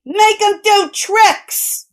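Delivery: high and slow, mocking; jeering crewman. A narrator's reading. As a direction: drag out the taunt.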